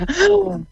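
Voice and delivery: a sharp, high gasp of breath; demo gasp. Shown as a demonstration. Showing a laughter class how to breathe in, sharp high gasp. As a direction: gasp in to show the deep breath. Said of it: "Take a deep breath in".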